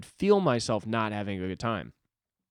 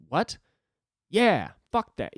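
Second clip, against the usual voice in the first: higher, louder, slowed sarcastic snap; mock angry outburst; 9 semitones higher, 6 dB louder, slower than usual. Acting out his inner anger voice, mock angry outburst. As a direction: play the angry voice in your head dismissing everything. Voicing the angry guy inside.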